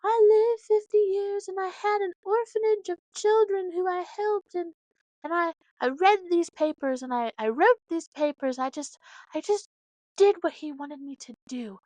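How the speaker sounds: a hint of Eliza pleading her legacy; lightly voiced Eliza. Recounting Eliza's final song with a touch of emotion. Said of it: "Eliza was like".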